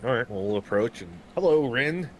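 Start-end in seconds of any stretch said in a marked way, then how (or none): 1.3-2.1 a bright, higher, louder hello called to a stranger; friendly greeting. Acted. Adventurer hailing the halfling, bright and louder. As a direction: call out cheerily to the farmhand.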